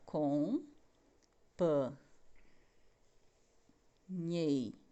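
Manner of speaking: "Companheiríssimo" split into slow syllables as it is written. Shown as a demonstration. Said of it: "separando a sílaba". (separando "Companheiríssimo" em sílabas, bem devagar)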